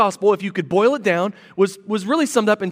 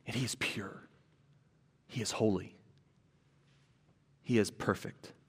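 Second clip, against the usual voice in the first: much softer, breathed whisper of awe; hushed whisper; 11 semitones lower, 16 dB quieter than usual. Real, not acted. Preacher to congregation on God's purity, whispered. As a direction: drop to a reverent whisper. Hushed close after God is light.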